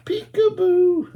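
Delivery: put-on high, slow, drawn-out peekaboo for little kids; spring out with a high playful peekaboo; excited peekaboo. Owl pops up in book.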